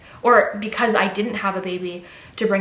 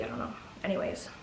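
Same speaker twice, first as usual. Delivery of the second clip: ordinary talk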